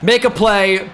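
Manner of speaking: high, loud hype shouting at a big play; excited shout. Real, not acted. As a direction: yell it like the crowd just erupted. Shouted excited game commentary.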